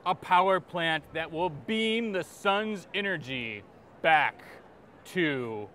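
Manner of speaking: slow, dramatic trailer announcer; movie trailer narration. Acted. Author pitches his cat book, movie trailer voice. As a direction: draw it out like an epic film trailer.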